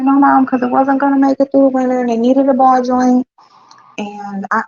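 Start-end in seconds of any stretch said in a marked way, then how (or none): 0.0-3.3 higher, lightly voiced daughter excuse; a hint of the daughter explaining herself; mother lightly imitating daughter's excuse. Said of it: "She's like".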